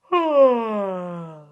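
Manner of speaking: long loud acted yawn; big yawn. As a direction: give a big stretching yawn.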